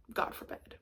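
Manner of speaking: a hushed, scandalized aside; whispered mock horror. Vlogger mocking the family's snobbery in a hushed whisper. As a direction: whisper it like clutching pearls.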